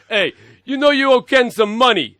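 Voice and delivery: loud, high mobster shakedown voice; tough guy Guido voice. Retold or imitated. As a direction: lean on him like a debt collector. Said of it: "flipping Guido showing up at my door going".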